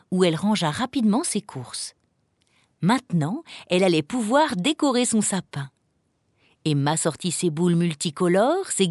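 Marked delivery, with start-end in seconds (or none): none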